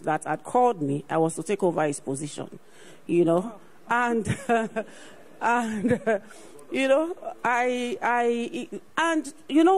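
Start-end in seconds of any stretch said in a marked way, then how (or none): 4.3-5.1 a short delighted laugh; brief joyful chuckle mid-story; happy laugh. Real, not acted. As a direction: let a real laugh slip out. Joyful laugh recounting promotion.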